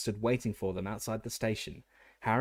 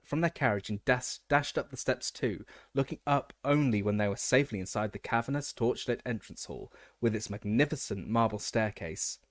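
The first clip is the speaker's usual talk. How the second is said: ordinary delivery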